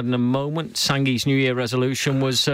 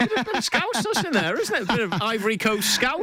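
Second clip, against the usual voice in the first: mock squeal; 10 semitones higher than usual. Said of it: "teasing the footballer's accent".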